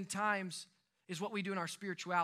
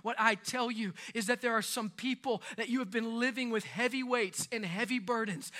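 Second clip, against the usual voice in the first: ordinary talk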